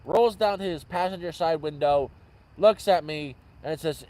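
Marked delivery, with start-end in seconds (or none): none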